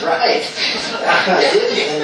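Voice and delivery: amused reply said through laughter; laughing. Retold or imitated. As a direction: chuckle through the words, pleased. Retelling a man's laughing reply.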